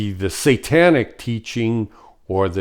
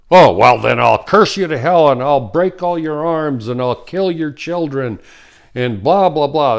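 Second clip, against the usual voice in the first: higher, louder mock threat, cursing and menacing; mock threatening rage; 5 semitones higher, 5 dB louder than usual. Shiva turning on someone who refused him, mock angry. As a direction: spit out the curses like a spurned tyrant.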